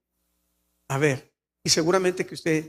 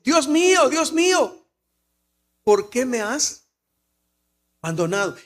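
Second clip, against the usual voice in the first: anguished cry; 3 semitones higher than usual. Retold or imitated. Quoting the psalmist's anguished cry.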